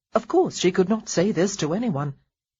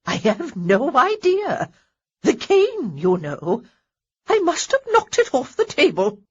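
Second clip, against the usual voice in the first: exaggerated regret; 8 semitones higher, 5 dB louder than usual. A narrator's reading. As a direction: ham up the innocence. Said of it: "with what Honoria suspected was exaggerated regret".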